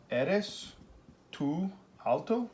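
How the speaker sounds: slow, voice rising to alto; rising question tune. Shown as a demonstration. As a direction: ask it, lifting the end. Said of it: "there's my question".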